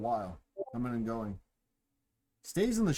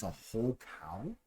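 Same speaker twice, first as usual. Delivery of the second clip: softly mouthed, rising question; 7 dB quieter than usual. A narrator's reading. Barmaid checks the huge food order, mouthing it softly. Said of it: "she mouths".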